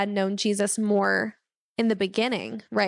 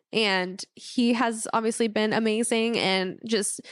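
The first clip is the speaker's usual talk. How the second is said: usual speaking voice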